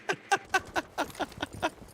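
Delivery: breathy giggle after a joke; high airy laugh. Real, not acted. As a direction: laugh off the sarcastic jab. Laughing at his own sarcasm.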